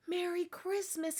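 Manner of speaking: soft, hushed greeting; a quiet Christmas wish at the mouse hole; soft whisper. Acted. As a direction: hush it so the mice don't wake. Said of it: "he whispered".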